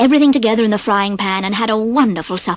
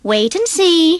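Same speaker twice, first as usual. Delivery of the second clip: mysterious; 7 semitones higher, slower than usual. A narrator's reading. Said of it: "he said mysteriously".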